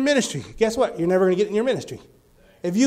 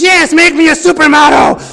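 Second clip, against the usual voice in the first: high, loud, put-on eager reply; higher, louder voice of the young man; eager boy voice; 6 semitones higher, 10 dB louder than usual. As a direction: shout it like a hopeful young man. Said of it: "He goes".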